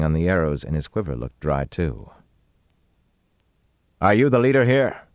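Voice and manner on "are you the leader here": raised, higher, commanding question; forceful challenge; demanding. A narrator's reading. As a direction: demand an answer loudly. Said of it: "Rand demanded".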